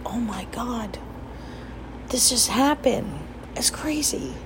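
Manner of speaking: hushed excited whisper. Retold or imitated. Whispering the news to her friend, excited hush. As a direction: breathe it out, voiceless and secret.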